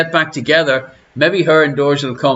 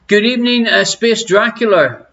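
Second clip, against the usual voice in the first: sing-song greeting; 6 semitones higher than usual. Acted.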